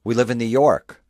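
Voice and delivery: put-on high, loud know-it-all kid; incredulous kid. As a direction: talk like a smart-aleck eight-year-old. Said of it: "eight-year-old's gonna go".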